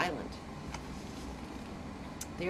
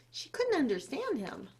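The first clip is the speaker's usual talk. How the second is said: plain reading voice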